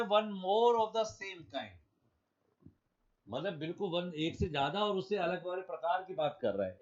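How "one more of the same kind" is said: very slow, a bit higher and louder; deliberate classroom emphasis; slow emphatic read. Shown as a demonstration. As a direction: stretch every word so it sticks. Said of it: "वो कहता है".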